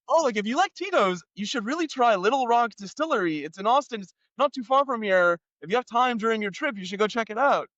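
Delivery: high, chatty imitation of the cop; mock-cheerful police officer; cop's voice. Cop voiced recommending distillery.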